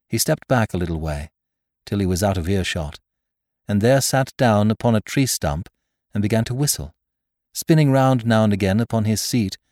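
ordinary delivery, nothing marked

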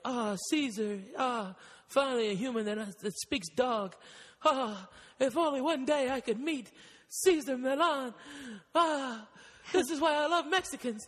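doting dog voice; high, adoring dog talking to his hero. Retold or imitated. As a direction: gush like a lovestruck dog. Dog's inner voice voiced.

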